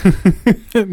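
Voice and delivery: short, high burst of laughter; quick amused giggle. Real, not acted. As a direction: laugh out loud at it. Reacting to her geeky clip.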